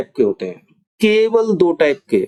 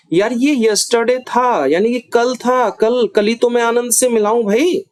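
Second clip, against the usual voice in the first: higher, slow insisting on yesterday; insistent emphasis; 6 semitones higher, slower than usual. Shown as a demonstration. Teacher acts out insisting to show stress. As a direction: insist it was yesterday, friend. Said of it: "तो मैं किस पे जोर दे रहा हूं?".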